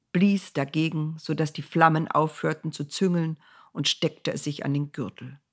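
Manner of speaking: ordinary delivery, nothing marked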